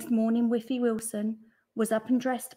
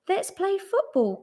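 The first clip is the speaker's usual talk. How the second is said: put-on a higher-pitched boyish call; 10 semitones higher, 4 dB louder than usual. A narrator's reading. Boy calls his friends to play, calling out. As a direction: call it out to playmates. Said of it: "called a boy".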